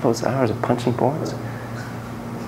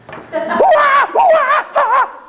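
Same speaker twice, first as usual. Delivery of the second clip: high shrieking ape whoop; wild monkey screech; 4 dB louder than usual. Acted. Monkeys furious over banana, wild screeching whoop.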